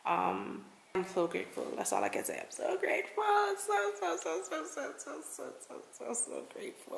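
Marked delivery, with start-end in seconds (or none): 2.4-3.2 much higher, cutesy squeal; overwhelmed high-pitched gush; squeaky high. Real, not acted. Repeats grateful line twice. 3.6-6.9 high repeated so, fading to a whisper; overcome, repeating until it's only breath; gushing fade. Real, not acted.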